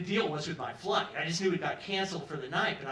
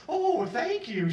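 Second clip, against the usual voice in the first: high, slow, flattered thank-you; flattered stewardess; 10 semitones higher, 4 dB louder, much slower than usual. Retold or imitated. Stewardess flattered by the compliment, high and slow. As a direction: stretch it out high and pleased.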